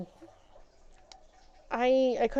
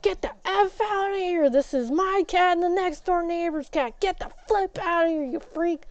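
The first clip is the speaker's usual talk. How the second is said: angry yell; 7 semitones higher than usual. Retold or imitated. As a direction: chase the thief away. Yelling at cat thief.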